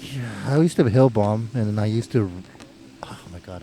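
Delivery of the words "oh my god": embarrassed whisper. Real, not acted. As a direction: breathe it out under your breath. Embarrassed before confessing a story.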